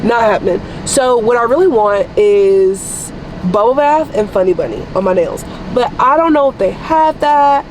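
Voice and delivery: usual speaking voice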